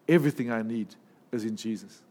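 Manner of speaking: usual speaking voice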